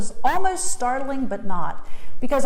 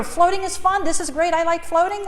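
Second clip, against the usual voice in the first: put-on higher, sing-song like a five-year-old; mock her own childish words, pitched up; childlike voice; 6 semitones higher than usual. Mocking her simple thoughts, lightly childlike. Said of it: "I sound like a five-year-old".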